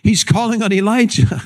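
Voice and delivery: high-pitched acted bystander; imitating an eager onlooker; excited onlooker. Voices bystanders mishearing the cry.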